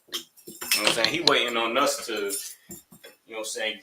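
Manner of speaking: ordinary talk, nothing marked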